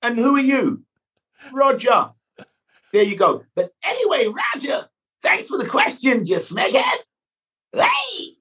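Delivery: high, slow Red Dwarf Cat joke. Pretend. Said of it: "in your cat voice".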